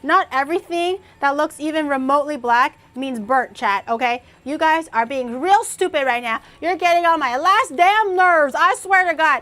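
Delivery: loud, high, exasperated scolding; angry rant at chat. Real, not acted. Streamer loudly scolding chat over the crust. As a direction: tell chat off, fed up and loud.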